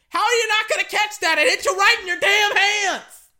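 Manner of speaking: loud, high-pitched exasperated yelling; annoyed yell. Real, not acted. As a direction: yell at a receiver who dropped the ball. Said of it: "I'm so annoyed right now".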